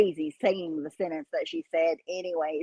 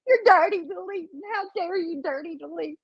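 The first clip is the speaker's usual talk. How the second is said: mocking whiny imitation; 8 semitones higher than usual. Mocking Starlight's post.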